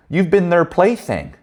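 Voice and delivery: slow, high teasing line "you've been their plaything"; drawn out and high, needling him knowingly; knowing jab. Retold or imitated. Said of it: "And I said".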